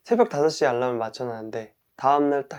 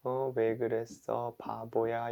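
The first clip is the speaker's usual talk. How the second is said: self-insulting; 2 semitones lower, 10 dB quieter than usual. Pretend. Person who overslept insults themselves, muttered scolding. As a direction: grumble insults at yourself, much softer. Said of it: "막 욕하는 거예요".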